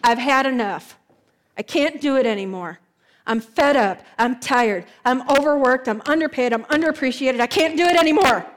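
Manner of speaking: loud frustrated venting; fed-up outburst. Retold or imitated. Worker fed up at the table, venting loudly. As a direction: slam the table, overworked and done. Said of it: "hit the table and go".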